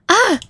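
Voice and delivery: sharp yelp of pain; pained cry. Acted. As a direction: cry out as the arm twists. Arm twisted, cries out.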